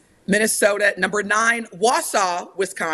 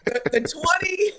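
high, real laughter breaking into the words; laughing; 7 semitones higher than usual. Real, not acted. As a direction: try to read on through the giggles. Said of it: "why am I laughing?".

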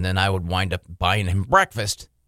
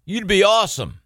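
booming radio voice; 8 semitones higher than usual. Retold or imitated. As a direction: talk like a proud radio dad. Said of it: "My dad had the radio voice".

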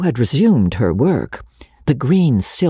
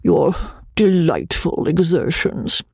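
higher-pitched, chuckling fussy gentleman; awkwardly gallant suitor voice, pitched up; flustered gallant Joseph; 6 semitones higher than usual. Acted. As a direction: gush gallantly, with little laughs between phrases. Narrator plays pompous suitor Joseph.

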